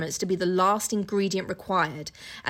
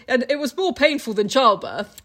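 mocking Jekyll; 3 semitones higher, 4 dB louder than usual. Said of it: "he just said".

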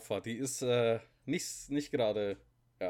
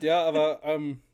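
stifled giggles; 4 semitones higher, 9 dB louder, slower than usual. Real, not acted. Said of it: "jetzt hör auf zu lachen".

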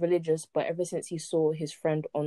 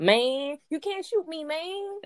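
movie character voice; 11 semitones higher, slower than usual. Acted.

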